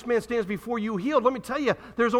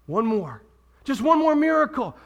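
higher, louder mock plea for one more miracle; 3 semitones higher, 4 dB louder than usual. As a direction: whine it like a demanding crowd. Crowd begging for more.

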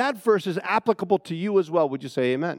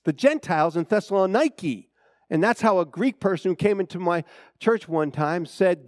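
ordinary delivery

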